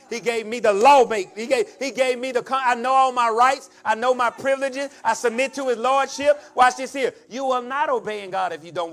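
loud, insistent declaration; fired-up preaching. Real, not acted. Preacher claiming rights given by God, fired-up. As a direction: drive each claim home forcefully.

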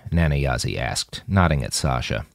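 plain reading voice

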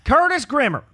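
put-on high, loud country-accent call; mimicked home accent. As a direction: holler the name in a mock country accent. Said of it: "this is your accent".

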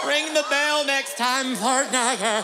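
high hammy voice. Acted. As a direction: play it like a country kid shouting. Acted door scene, high voice.

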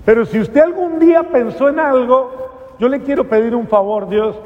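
a desperate plea pitched high and strained; pleading prayer. Retold or imitated. Young addict on his knees begging God for purpose, high pleading.

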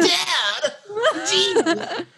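high, laughing mock exasperation; exasperated son. Teen son cringing at his dad, high laughing exasperation. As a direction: squeal it like an embarrassed teen.

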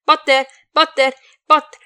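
insistent phone shout; yelling. A narrator's reading. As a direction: yell the name over and over to get through. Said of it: "non è il caso che urli".

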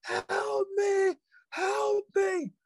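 very high, drawn-out mock shriek; piercingly high, held-back wail; imitated curdling scream. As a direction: imitate a blood-curdling scream without full volume. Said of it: "curdling screams, just loud".

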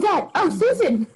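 high, surprised double take. Retold or imitated. Friends startled to see the roommate, surprised. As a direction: act startled to spot her. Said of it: "We're like".

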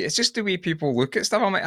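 ordinary talk

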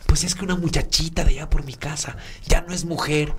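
lightly voiced worried housekeeper; worried lady. Housekeeper breaks the news to Marcos, mildly worried. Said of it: "bien preocupada".

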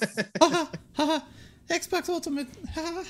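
high squeaky cartoon mouse laugh; Mickey Mouse voice. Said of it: "do it as Mickey Mouse".

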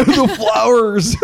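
laughing. Real, not acted. Laughing at spooky idea.

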